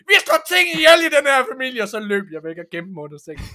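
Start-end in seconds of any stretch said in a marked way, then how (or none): 0.1-1.8 high, loud, fast declaration cracking into laughter; father laying down the family rule; mock stern father. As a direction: declare it like a gruff farm dad, laughing. Father voiced in story.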